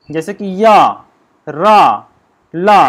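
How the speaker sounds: teacher demonstrating या रा ला; sounding out या रा ला. Shown as a demonstration. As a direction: let each sound ring out for students. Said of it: "ऐसे बोल के देखिए".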